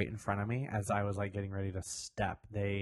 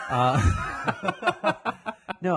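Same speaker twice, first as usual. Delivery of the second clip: laughing; 13 semitones higher, 9 dB louder than usual. Real, not acted. Laughing after own joke.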